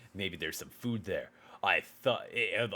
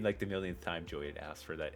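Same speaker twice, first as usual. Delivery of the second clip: ordinary delivery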